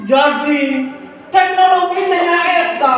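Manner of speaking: very high, exaggerated oratory; overdone speech intonation. Shown as a demonstration. Teacher showing how not to give a speech, overblown high delivery. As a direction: declaim it far too high. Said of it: "entong, entong dugi".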